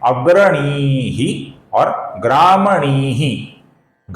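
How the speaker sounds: each word drawn out slowly; slow careful reading. Shown as a demonstration. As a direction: spell out अग्रणीः and ग्रामणीः slowly. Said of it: "यहाँ पर दो शब्द हैं". (धीरे-धीरे साफ़ उच्चारण)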